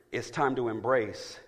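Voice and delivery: ordinary delivery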